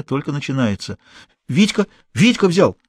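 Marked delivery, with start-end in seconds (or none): 1.5-2.8 higher, anxious thief's voice; a scared small-time crook blurting it out; a cornered accomplice answering the gang boss, high and nervous; frightened petty thief. A narrator's reading.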